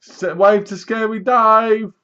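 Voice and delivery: mock the cheesy killer host; slow, high mocking villain voice; hammed-up creepy host. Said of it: "and he goes".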